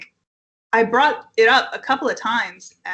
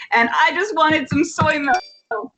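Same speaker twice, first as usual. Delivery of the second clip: self-pitying wail; 5 semitones higher, slower than usual. Retold or imitated. Lament after being terrorized.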